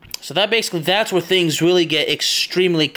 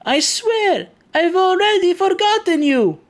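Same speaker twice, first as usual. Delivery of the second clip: much higher put-on voice, slow, half-comic; imitating the driver; slower than usual. Driver assuring Sam Fisher after the interrogation, in a high mock voice. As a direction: ham up the nervous driver swearing he forgot. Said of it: "the driver will say".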